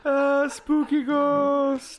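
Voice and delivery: high, long-drawn ghostly wail; spooky ghost. Pretend. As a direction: wail it slowly like a cartoon ghost. Playing the spooky ghost of the recap.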